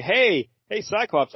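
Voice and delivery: high, mock hail to a teammate; calling out. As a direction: act out calling for attention. Acts Angel calling to Cyclops.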